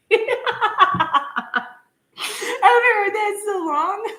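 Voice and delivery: high, loud, laughing through the words; real laughter; laughing hard. Real, not acted. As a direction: laugh till you almost cry. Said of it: "Oh, I'm gonna cry".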